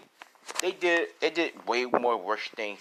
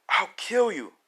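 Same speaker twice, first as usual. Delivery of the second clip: louder, higher death threat; heated, raised-pitch menace; 7 semitones higher, 4 dB louder than usual. Acted. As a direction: flare up on the threat. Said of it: "Deku screaming out".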